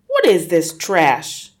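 loud, slow, contemptuous demand; angry father. Acted. Father snatching his daughter's book, loud and scornful. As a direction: sneer at the book as trash. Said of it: "he said".

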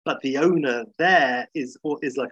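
ordinary talk, nothing marked

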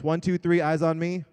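rally the room to attention; kindergarten teacher voice. Shown as a demonstration. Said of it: "So let's practice".

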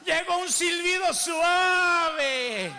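drawn out and loud; slow preaching shout. Real, not acted. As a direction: shout the phrase slowly. Gentle whisper repeated, shouted.

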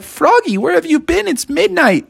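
high, urgent questioning; worried parents. Acted. Parents to Froggy at midnight, worried and high-pitched. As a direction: fret at a child home late. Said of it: "his parents were so worried".

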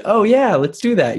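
high, bouncy sing-song lilt. Shown as a demonstration. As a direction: lift every word up brightly. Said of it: "the whole sing-song voice".

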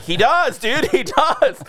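high, laughing "He does, dude"; emphatic agreement. Real, not acted. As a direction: agree with mock exasperation. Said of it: "I'm like".

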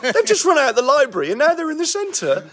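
high, laughing disbelief at the TV; incredulous outburst. Retold or imitated. Watching TV with his wife, laughing, high-pitched disbelief. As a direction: cry out that this isn't the right part of town, with a laugh. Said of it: "Me and my wife went".

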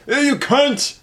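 put-on British insult; mock British accent. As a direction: imitate a Brit cursing in a high voice.

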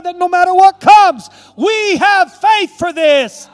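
loud, high prayer building to a shout; full-force preaching prayer. Real, not acted. Pastor closing the service in prayer, shouted and building. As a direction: build from fervent thanks to a roaring climax.

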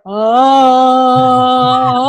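loud, long held "oh"; imitated singing wail. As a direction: belt out one mock rock note. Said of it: "No, it was more like".

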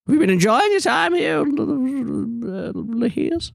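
high-pitched eccentric hostess voice; eccentric ancient hostess. Acted. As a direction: greet a guest in a high, odd character voice. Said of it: "and she says".

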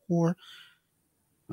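accidental high-pitched voice slip; voice crack. Real, not acted. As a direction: voice suddenly jumps up high. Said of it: "my voice just did that".

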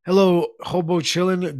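normal talking voice

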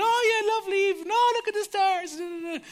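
high-pitched, flustered chatter; put-on high voice covering nerves; nervous small talk. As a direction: dodge the question in a high, silly voice. Acting out chickening out of proposing.

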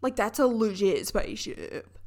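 hammed-up amazement at the obvious; annoying excitement. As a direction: imitate yourself gawking at a spaceship. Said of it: "was probably so annoying".

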